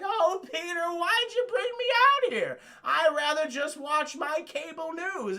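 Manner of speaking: put-on high, slow little old lady voice; quavery granny squeak, drawn out; old Aunt May. Streamer voicing old Aunt May complaining to Peter, high and slow. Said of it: "Aunt May is like".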